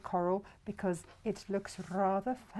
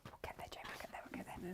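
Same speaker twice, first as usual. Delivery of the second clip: soft whispered self-encouragement; whispering; 2 semitones lower, 18 dB quieter, faster than usual. Real, not acted. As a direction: whisper it under your breath.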